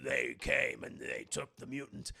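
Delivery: low beast-man voice; Sabretooth. Acted. Sabretooth reports to his boss on the mutant, low beast voice. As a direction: drop low like a brutish henchman. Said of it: "Sabretooth's like".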